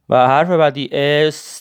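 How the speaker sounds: ordinary talk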